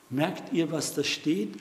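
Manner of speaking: usual speaking voice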